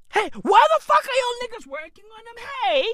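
high, fast shout of outrage; exasperated shout. Real, not acted. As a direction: yell the question at them. Outrage at AI developers.

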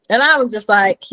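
normal talking voice

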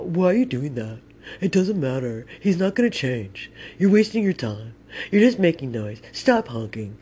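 put-on nagging voice; scolding bystander. Parked bystander tells scooter rider to stop honking, nagging mock voice. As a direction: imitate someone lecturing in short, separate scolds.

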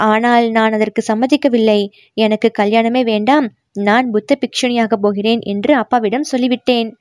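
usual speaking voice

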